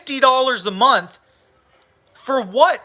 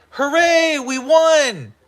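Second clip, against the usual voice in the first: mock cheer; 6 semitones higher, slower than usual. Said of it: "They went".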